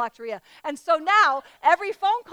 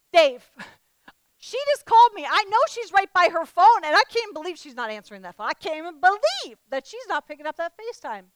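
exasperated disbelief; 4 semitones higher, 5 dB louder than usual. Retold or imitated. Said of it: "and I'm like".

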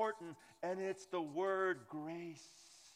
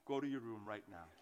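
mock stern dad; 12 semitones lower, 5 dB quieter, faster than usual. Dad jokingly grounds daughter.

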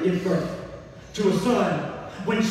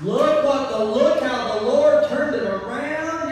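higher, driving preacher voice; full-force preaching; 6 semitones higher, 5 dB louder than usual. Acted. Preacher telling the church that God keeps his promise, full force. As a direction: preach it out to the congregation.

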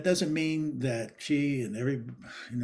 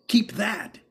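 sharp, higher mock yell of keep that; retold teacher yell; 8 semitones higher, 5 dB louder than usual. Acting teacher yelling at a student to keep a moment, mock yell. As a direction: bark it like a coach mid-scene. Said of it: "She would yell at you".

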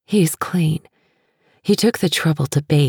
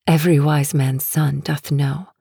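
usual speaking voice

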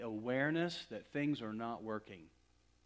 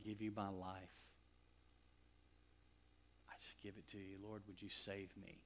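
humble prayer; 8 dB quieter than usual. Retold or imitated. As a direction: pray it gently, like a first surrender. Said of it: "a prayer that sounded a little bit like this".

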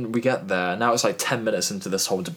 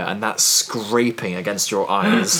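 slow, weighty, each word dragged; slow vivid emphasis; much slower than usual. Real, not acted. Describing the pepper-spray pain to friends, slow and vivid. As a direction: paint the pain slowly for them. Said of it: "imagine a razor on fire".

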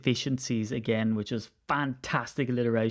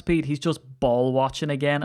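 ordinary talk, nothing marked

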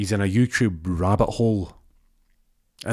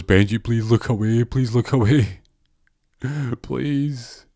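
loud, higher begging, dropping to a last please; pleading; 5 semitones higher, 4 dB louder than usual. Acted. Ron on his knees begs Benji to look away, pleading. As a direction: beg desperately, hammed-up. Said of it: "Ron's down on his knees pleading".

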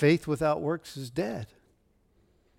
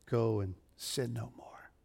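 whispered; 5 semitones lower, 12 dB quieter than usual. Retold or imitated. Jesus speaks gently to the woman.

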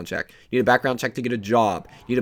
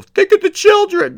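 very high, loud mock pleading; over-the-top sarcastic parody of a worried liberal; 13 dB louder than usual. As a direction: squeal it like a hand-wringing liberal. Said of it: "all the liberals were".

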